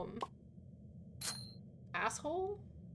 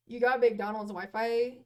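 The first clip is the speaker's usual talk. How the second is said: normal talking voice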